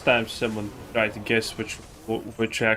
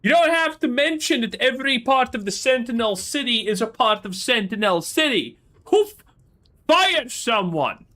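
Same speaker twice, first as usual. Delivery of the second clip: exasperated rant; 11 semitones higher than usual. Real, not acted. Cohost berates the reader for repeating Sentinel, exasperated and high. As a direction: plead with him to stop repeating it.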